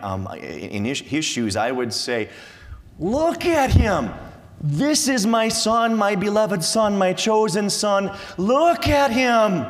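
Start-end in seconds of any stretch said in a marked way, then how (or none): 3.0-8.2 high, loud showing off; preacher imagining how he would boast as the Father, high and loud; proud proclamation. Pretend. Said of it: "If I were the Father". 8.4-9.7 preacher as a boastful Father, high, loud and slow; proud proclamation. Pretend. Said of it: "If I were the Father".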